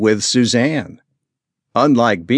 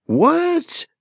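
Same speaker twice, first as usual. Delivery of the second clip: high, startled exclamation; mother sputters at the children's news; sputtering. A narrator's reading. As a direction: caught off guard, it bursts out. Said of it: "Suzanne sputtered".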